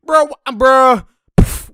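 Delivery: high, loud exasperated outburst; real frustrated shouting. Real, not acted. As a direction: yell at the player in disbelief. Exasperated at a player's bad play.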